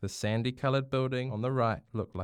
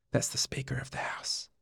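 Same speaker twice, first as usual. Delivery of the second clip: whisper; 3 semitones higher, 3 dB quieter than usual. Acted. Dad whispering in gallery.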